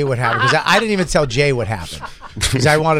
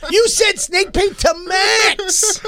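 mock outraged yell. Co-host accuses host in a mock child's high yell. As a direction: screech it like a betrayed child.

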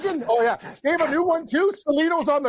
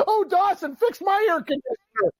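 put-on higher, laughing swoon of an old lady; mock old lady swooning; 9 semitones higher than usual. Host imitates an old lady in the ad, laughing and swooning high. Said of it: "all the old ladies are trying to catch him".

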